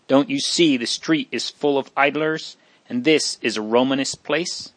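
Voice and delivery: ordinary delivery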